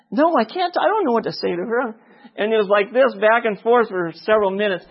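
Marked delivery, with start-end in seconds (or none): 0.1-1.9 high-pitched acted balking; panicky protest. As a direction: back out, pitch jumping up. Backs out of acted prompting.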